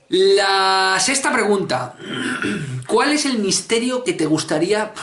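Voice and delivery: question announcement. Acted. Reading out next question.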